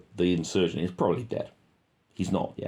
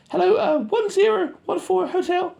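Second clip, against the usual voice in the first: much higher, louder mock radio talk; squeaky radio voice; 7 dB louder than usual. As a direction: mimic a soldier on the radio, squeaky. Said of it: "He's on the radio again".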